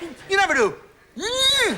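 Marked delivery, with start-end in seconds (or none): 1.2-1.8 aggressive shout. Pretend. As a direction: bark it out at someone. Acting out aggressive gesture.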